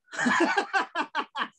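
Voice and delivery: high gleeful laugh that falls; triumphant real laughter; delighted laugh. Real, not acted. Laughs at his haggling win.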